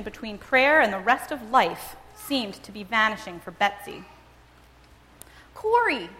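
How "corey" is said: high, eager call of a name; excitedly. A narrator's reading. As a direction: call her sister bursting with an idea. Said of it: "she said excitedly".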